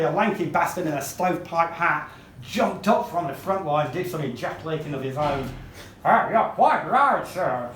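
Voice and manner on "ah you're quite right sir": loud, exaggerated Yankee twang; ridiculous Yankee accent. Lanky bastard jumping up from the front, ridiculous Yankee accent. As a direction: put on a silly Yankee accent. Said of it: "in a ridiculous Yankee accent".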